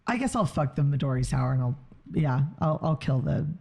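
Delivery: usual speaking voice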